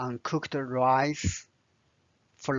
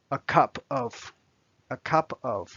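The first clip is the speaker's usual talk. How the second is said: usual speaking voice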